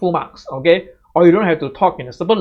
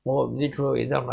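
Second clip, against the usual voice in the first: mumbled, much softer; mumbling; 4 semitones lower, 7 dB quieter, faster than usual. Shown as a demonstration. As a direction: demo an unclear mumble under the breath. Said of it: "Do not mumble".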